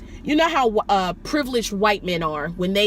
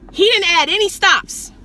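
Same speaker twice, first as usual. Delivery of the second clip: raised, higher, indignant voice; high and loud with exasperation; exasperated; 8 semitones higher, 6 dB louder than usual. Real, not acted. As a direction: burst out loud in complaint. Exasperated complaint about the passenger.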